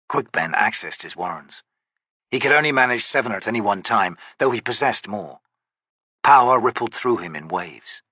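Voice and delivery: ordinary talk, nothing marked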